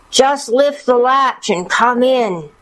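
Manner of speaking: high, slow put-on granny voice; wolf's false granny voice, high and slow; wolf posing as grandmother. A narrator's reading. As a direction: coax her in with a drawn-out old-lady voice. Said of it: "the hoarseness of Grandmother's voice".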